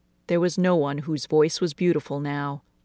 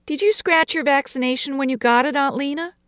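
put-on higher, timid child asking; little girl voice; 10 semitones higher than usual. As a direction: ask like a small girl. Lucy asks her aunt.

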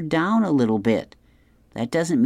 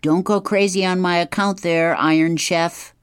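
pitched-up sarcastic whoa, lightly acted; Elliot; 7 semitones higher than usual. A narrator's reading. Son on phone mocks dad's breakfast offer, high sarcastic voice. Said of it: "Elliot says".

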